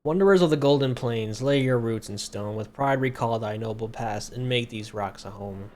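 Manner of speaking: loud, ceremonious proclamation, slowing at the end; grand inscription reading. Pretend. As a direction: declaim it like an ancient carved oath. Player reads second inscription dramatically.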